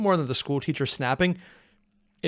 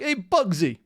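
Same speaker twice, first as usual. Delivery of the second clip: mock New Jersey accent; 6 semitones higher, slower than usual. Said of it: "you gotta say it with, like, a New Jersey accent".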